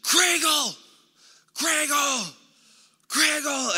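high-pitched, drawn-out holler from the bench; imitated coach yelling. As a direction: call out the name like a yelling coach. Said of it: "he starts yelling from the bench".

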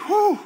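very high, sharp relieved whew. Real, not acted. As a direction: let out a huge squeak of relief. Relief after debt forgiven.

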